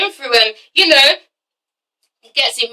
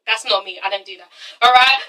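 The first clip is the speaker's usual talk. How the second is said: usual speaking voice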